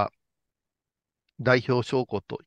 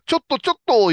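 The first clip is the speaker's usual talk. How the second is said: sharp raised call from behind, mock urgent; retold call; 13 semitones higher, 7 dB louder, faster than usual. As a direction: call out to stop him, imitating. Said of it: "いう声がして". (呼び止める声をまねて)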